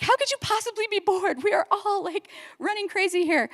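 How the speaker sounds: high, incredulous mock exasperation; exasperated. Family member scoffing at a bored sister, incredulous. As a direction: act baffled that anyone could be bored.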